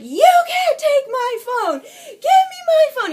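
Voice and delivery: very high, loud wailing demand; re-enacted desperate scream. She screams at him from the bed for her phone, re-enacted desperation. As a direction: cry it out, high and stretched.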